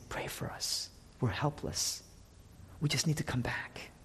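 hushed, pleading whisper; whispered plea. Retold or imitated. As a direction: whisper it like a desperate prayer. People begging God, voiced.

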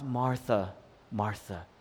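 hushed, low, tender address; moved, emotional. Retold or imitated. Jesus responds to an overworked Martha, moved and tender. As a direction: say the name softly, deeply moved. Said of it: "He's emotional. He's moved by that".

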